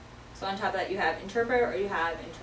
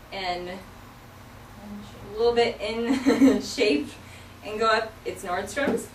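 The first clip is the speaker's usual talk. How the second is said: ordinary talk, nothing marked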